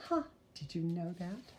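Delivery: ordinary delivery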